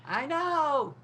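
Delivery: very high delighted I know; bubbly proud reply; cheerful. Acted. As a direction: chirp it proudly.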